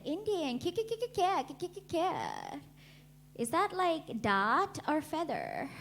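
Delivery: high mock American accent stuttering the "ki-" run up high; hammed-up ditzy American accent; clueless American girl. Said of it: "this American girl".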